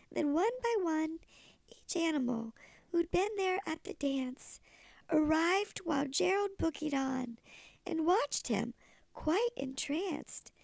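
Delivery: ordinary delivery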